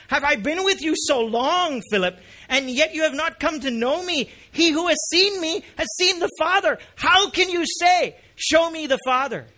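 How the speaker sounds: high, loud incredulous questioning; strong, astonished rebuke. Acted. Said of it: "show you how strong this how is".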